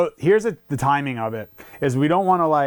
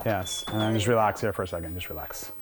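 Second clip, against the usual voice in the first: soft, lower calming voice; soothing; 6 semitones lower, 7 dB quieter than usual. Real, not acted. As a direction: settle the nervous dog gently. Calming the anxious dog.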